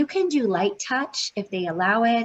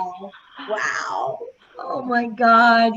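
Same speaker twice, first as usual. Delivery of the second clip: real shock; 4 semitones higher, 5 dB louder, much slower than usual. Real, not acted. Shocked at the stranger's comment.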